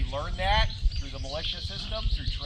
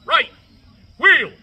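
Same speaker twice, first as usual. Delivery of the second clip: shouted command; 12 semitones higher, 16 dB louder, slower than usual. Acted. Drill command.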